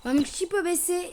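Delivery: usual speaking voice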